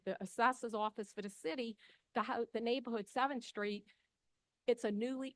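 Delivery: normal talking voice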